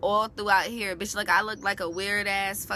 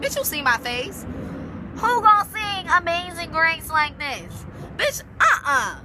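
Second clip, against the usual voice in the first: much louder and higher, slowed indignant protest; outraged refusal; 9 semitones higher, 6 dB louder than usual. Retold or imitated. Kid refusing the choir director, loud and outraged. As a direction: shout down the idea of singing like this.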